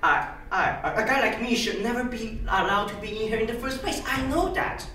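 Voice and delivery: hesitant, stammering climb-down after an outburst; halting apology. Acted. Mister Smith apologises to the honorable body, faltering. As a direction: apologise haltingly, restarting your sentences.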